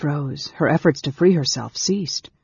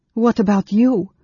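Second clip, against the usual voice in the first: put-on high, louder girl's voice; trembling girl; 7 semitones higher, 6 dB louder than usual. A narrator's reading. Girl questions the mage who holds her, anxious. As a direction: pitch up as the frightened girl.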